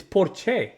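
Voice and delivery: Porsche as "por-CHAY"; Spanish pronunciation. Shown as a demonstration. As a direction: stretch Porsche into two syllables ending "chay". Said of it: "That's how they say it".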